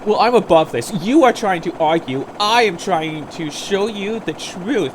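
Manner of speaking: loud, self-righteous declaration; mock superior. Opponent refusing to keep arguing, mock superior. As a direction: imitate someone pompously above the argument. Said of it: "pretends that they are so darn well above it all".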